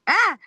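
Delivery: sudden high yelp of effort; a short sharp yelp mid-move; retold yell. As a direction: cry out as you leap off the rope. Shout after flipping off rope.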